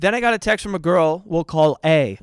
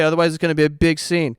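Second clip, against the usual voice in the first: ordinary talk, nothing marked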